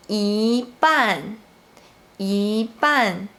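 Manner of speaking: "yi" rising before falling "ban"; second-tone yi. Shown as a demonstration. Teacher gives an example of the tone rule, rising yi. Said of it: "should be pronounced in the second tone".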